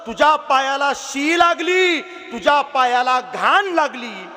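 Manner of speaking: a loving mother calling her son close; tender mother. Acted. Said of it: "आई हळूच कानात बोलते".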